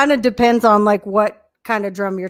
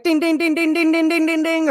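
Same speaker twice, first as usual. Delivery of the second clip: slot machine dings; 6 semitones higher than usual. Retold or imitated. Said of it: "instead of it just being like".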